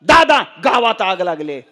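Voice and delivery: loud mock cry of alarm; tearful third son. Third son comes crying with fire news, loud cry. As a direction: cry out to dad as a distraught son. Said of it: "तिसरं पोरग रडतच आलं".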